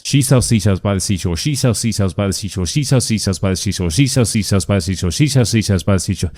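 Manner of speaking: fast, unbroken repetitions in a steady rhythm; rapid tongue twister. Shown as a demonstration. Coach demonstrating repeats without messing up, rapid-fire. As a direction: race through the twister over and over. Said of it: "saying this as many times as you can in a row".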